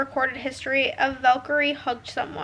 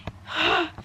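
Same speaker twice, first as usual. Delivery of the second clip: gasp; 3 semitones higher, 4 dB quieter than usual. Real, not acted. Reaction to story.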